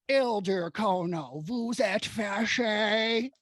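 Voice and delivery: slow, hammed-up bad French accent; terrible French accent. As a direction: drag it out in a clumsy French accent. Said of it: "in his worst, terrible French accent".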